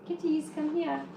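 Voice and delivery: high, coaxing kitty call; high sing-song summons to pets; calling the cats. Real, not acted.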